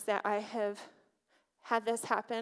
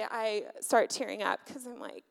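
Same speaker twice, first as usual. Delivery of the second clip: usual speaking voice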